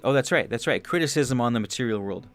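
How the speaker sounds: normal talking voice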